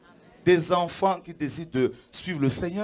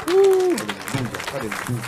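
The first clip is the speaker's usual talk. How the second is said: ecstatic cry; 6 dB louder, slower than usual. Real, not acted. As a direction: stretch it out like a shout of praise. Pastor rejoicing at baptism candidates.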